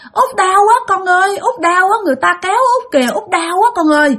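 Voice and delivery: high, plaintive repeated cries; pained wailing. Retold or imitated. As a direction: wail high as if hurting badly. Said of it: "út của bạn gào lên, la làng lên".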